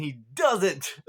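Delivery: "doesn't" drawn out, high and loud; exasperated emphasis. Real, not acted. Viewer let down by Koichi's silence, "doesn't" drawn out loud. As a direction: stretch "doesn't" loudly in amused frustration. Frustrated punchline about character's silence.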